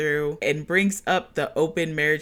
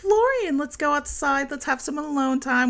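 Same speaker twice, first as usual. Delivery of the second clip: sugary, much higher put-on sweetness; cutesy; 10 semitones higher than usual. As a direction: coax him outside, all cute. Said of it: "Was, like, cutesy about it".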